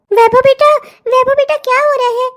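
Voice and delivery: loud call with rise on बेटा. Acted. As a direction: call to him through the door. Mother calls from outside. (बेटे को बाहर से पुकारती माँ)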